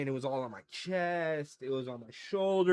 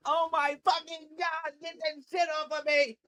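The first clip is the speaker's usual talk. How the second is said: high, strained cry of pain, much higher; pained scream; 12 semitones higher than usual. Retold or imitated. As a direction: wail it like your face is burning. Retold pepper spray agony.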